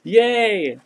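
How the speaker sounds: high little cheer; a quick happy yay; excited cheer. Real, not acted. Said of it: "I'm really excited".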